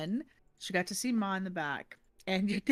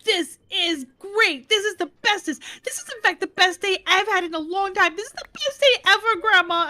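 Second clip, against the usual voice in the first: put-on high, fast, breathless little girl voice; excited little girl; 8 semitones higher, 7 dB louder than usual. Granddaughter to grandma about the car seat, high excited child voice. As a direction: gush like a thrilled small child, much higher. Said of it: "She's like".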